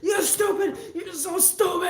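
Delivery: lightly imitated crying yell; tearful insult. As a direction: whine the insult. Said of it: "he's like".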